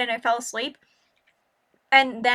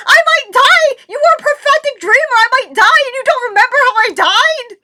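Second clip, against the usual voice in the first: outraged shout; 12 semitones higher, 11 dB louder than usual. Retold or imitated. Outraged at cousin's forgotten dream.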